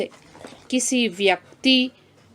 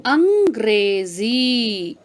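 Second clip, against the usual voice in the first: अंग्रेजी drawn out slowly and clearly; slow demo word; much slower than usual. Shown as a demonstration. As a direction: model अंग्रेजी slowly for pupils. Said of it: "next word है". (धीमे और स्पष्ट उच्चारण)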